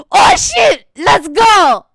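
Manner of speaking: high, loud yell of fan excitement; hyped-up cheer. Retold or imitated. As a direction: explode with hype at big news. Acts out her hyped reaction.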